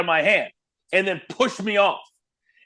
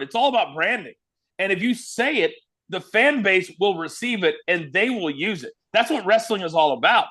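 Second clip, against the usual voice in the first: usual speaking voice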